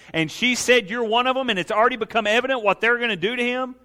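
high, insistent pulpit delivery; impassioned preaching. Real, not acted. Preaches at full force.

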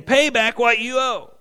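loud, high, slow, furious demand; a forgiven slave throttling the man who owes him; angry demand. Acted. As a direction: spit out each word as you choke him. Slave seizes and chokes his debtor.

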